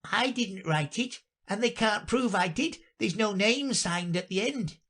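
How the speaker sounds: high-pitched prisoner's defence; high, unforced protest; protesting knave. Acted. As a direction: plead innocence in a high voice. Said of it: "said the knave".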